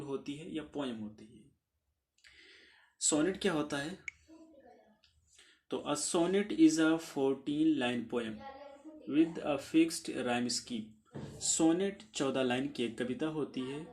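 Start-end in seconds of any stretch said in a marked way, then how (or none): 5.9-10.8 very slow, evenly spaced English definition; slow dictation pace; dictation reading. Acted. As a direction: read it out slowly so students can write it down.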